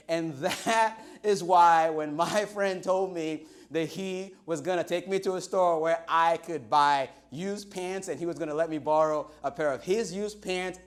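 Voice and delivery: usual speaking voice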